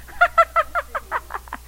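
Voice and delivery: very high, giggly cackle; squealing run of laughter; high-pitched laughter. Shown as a demonstration. Believer laughing at the devil, high gleeful laugh. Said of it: "A believer starts laughing".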